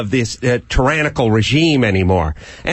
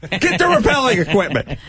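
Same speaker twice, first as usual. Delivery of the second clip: higher mock command; mock order; 9 semitones higher than usual. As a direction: bark the order. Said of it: "I would yell".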